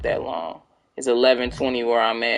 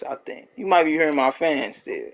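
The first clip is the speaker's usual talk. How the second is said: ordinary delivery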